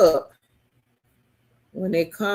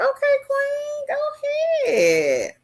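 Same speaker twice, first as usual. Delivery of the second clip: much higher "Okay, queen"; cheering high. Real, not acted. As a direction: hype her up, high and bright. Cheering a viewer on.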